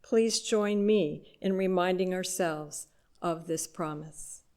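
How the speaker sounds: usual speaking voice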